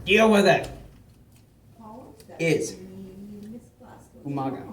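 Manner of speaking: chewing while talking; words around a bite of cracker; mouth full. Real, not acted. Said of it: "I'm talking with my mouth open".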